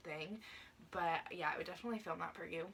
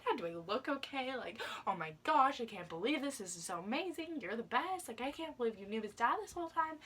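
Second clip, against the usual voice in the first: excited gushing; 5 semitones higher, 4 dB louder than usual. Retold or imitated. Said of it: "I was like".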